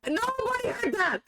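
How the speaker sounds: high squealing laugh through the words; laugh-yelp it out, flustered; embarrassed laughing cry. Real, not acted. Covering a slip nobody heard, laughing high.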